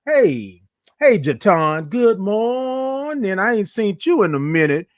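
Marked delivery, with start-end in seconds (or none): 1.9-3.4 high, long-held MORNING greeting; drawn-out morning. Real, not acted. Host greeting viewers by name, morning drawn out. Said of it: "like all those O's and R's".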